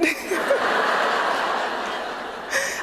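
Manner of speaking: high, breathless giggle; giddy laugh. Retold or imitated. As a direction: laugh like a starstruck fan. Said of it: "I'm screaming".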